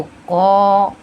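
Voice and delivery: "कौ" held long with a rounded "o"; long, full "कौ" coloured with "o"; heavy "o" sound. Shown as a demonstration. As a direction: fill the mouth on "कौ". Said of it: "ओ की sound आते हुए हम अदा करेंगे".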